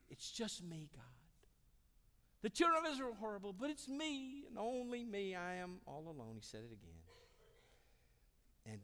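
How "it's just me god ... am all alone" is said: high-pitched self-pitying whine; hammed-up plaintive complaint; whining. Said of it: "He starts whining".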